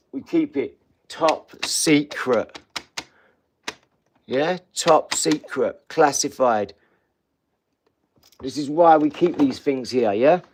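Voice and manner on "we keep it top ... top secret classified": slow and secretive. Real, not acted. Slow conspiratorial.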